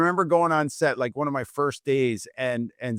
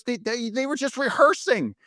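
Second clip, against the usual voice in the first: much higher, urgent scolding voice; exasperated manager; 12 semitones higher than usual. Retold or imitated. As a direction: act the manager in disbelief. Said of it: "And she goes".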